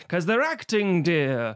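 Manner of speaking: hammed-up stage-actor voice; theatrical actor. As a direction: play the grand old actor dear. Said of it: "They do an impression".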